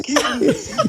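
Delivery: high, laughing through the words; laughing catchphrase. Real, not acted. Uncle on the phone laughing his catchphrase. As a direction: chuckle it out high and gleeful.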